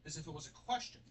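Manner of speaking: ordinary talk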